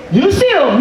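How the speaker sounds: high urgent call; high frantic question; calling across lot. Retold or imitated. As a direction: shout across the parking lot. Searching parking lot, calling friend.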